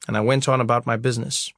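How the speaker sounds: plain reading voice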